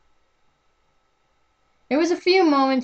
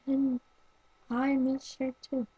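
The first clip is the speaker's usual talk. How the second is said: much softer, higher and tearful; quietly crying; 3 semitones higher, 8 dB quieter than usual. Acted. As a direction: confess it through quiet tears. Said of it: "the same sort of crying noise".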